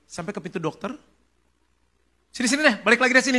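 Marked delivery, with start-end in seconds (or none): 2.3-3.4 quick, raised call to come back. Retold or imitated. Doctor to couple at the door, calling back. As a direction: call them back in a hurry, higher and louder.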